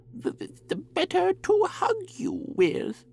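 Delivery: put-on wolf squeaking as an old lady; wolf as grandmother. A narrator's reading. Wolf stammers as granny.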